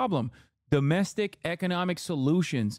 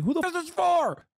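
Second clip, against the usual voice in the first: high-pitched, incredulous yell; exasperated outburst; 13 semitones higher than usual. Real, not acted. Streamer ranting at Harris's small-business pitch, incredulous high yell. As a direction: throw up your hands in disbelief.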